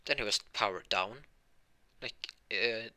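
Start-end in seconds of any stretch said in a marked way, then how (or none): none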